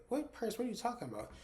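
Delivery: high, baffled questioning; incredulous. Retold or imitated. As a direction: imitate someone totally confused. Woman baffled about a purse.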